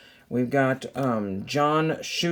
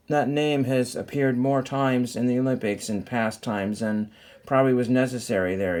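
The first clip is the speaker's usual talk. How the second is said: ordinary delivery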